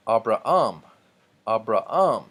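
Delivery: "Abraham" said a-bra-AM, no H, the last syllable loud; exaggerated final stress. Shown as a demonstration. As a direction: punch the AM ending "Abraham". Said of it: "putting so much emphasis".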